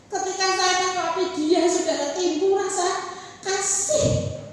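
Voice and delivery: loud, high, building sermon cadence; rising fervent sermon delivery; full-force preaching. Acted. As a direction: preach it to the congregation.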